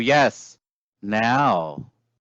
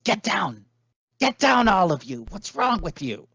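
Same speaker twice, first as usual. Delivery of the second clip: urgent scolding command; 9 semitones higher than usual. Acted. Tara ordering the adventurers to crouch, urgent high-pitched scolding. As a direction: order them down in a raised pitch.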